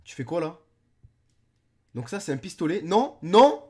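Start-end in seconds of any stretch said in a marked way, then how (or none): 2.8-3.6 loud high alarmed no. Real, not acted. Reacting to a kid with a pistol, loud alarmed no.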